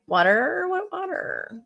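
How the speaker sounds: "What water?" jumps high, then falls away softly; mock oblivious. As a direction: play dumb to what is all around you. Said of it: "And it's like".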